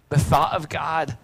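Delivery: usual speaking voice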